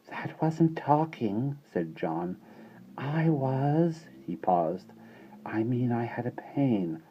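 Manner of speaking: put-on baby boy voice. A narrator's reading. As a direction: mumble it like an embarrassed baby.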